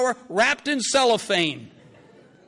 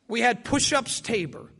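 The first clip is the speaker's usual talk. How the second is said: ordinary delivery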